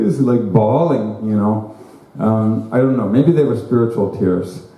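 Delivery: usual speaking voice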